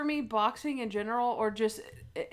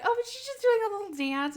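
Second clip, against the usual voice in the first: awkward fibbing Natalie; 11 semitones higher, faster than usual. Retold or imitated. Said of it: "I cringed when she's like".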